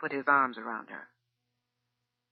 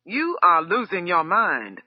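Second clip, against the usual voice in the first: slow, forceful shout at his wife; 3 semitones higher, 5 dB louder than usual. A narrator's reading. Husband shouting at his wife, slow and loud. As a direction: bark it out slowly. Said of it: "shouted Valerian".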